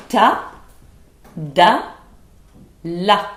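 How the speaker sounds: crisp, separate diction drill; diction syllables. Shown as a demonstration. Teacher demonstrating the syllables to students, crisp and clear. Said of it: "trois phonèmes assez simples".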